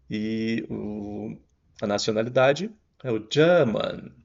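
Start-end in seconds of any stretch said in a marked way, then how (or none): 3.3-4.1 "German" in British English, with a long ER and no R; British English: a stretched, R-less "German"; British-accent German. Shown as a demonstration. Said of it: "prolongado ali em British".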